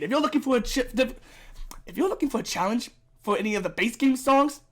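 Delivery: ordinary talk, nothing marked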